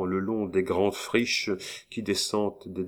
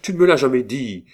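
louder, higher and fast, bursting out; abrupt outcry; 6 semitones higher, 8 dB louder, faster than usual. A narrator's reading. Brother reproaching his sister for her silence, an abrupt outcry. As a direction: blurt it as a sudden accusation. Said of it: "s'écria Léon brusquement".

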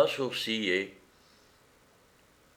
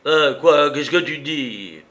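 loud stunned stammer; stammering shock; 3 semitones higher, 7 dB louder than usual. Acted. The stunned bandit stammers at Lupin, loud shocked. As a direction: blurt it, thrown off. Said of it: "Il balbutia".

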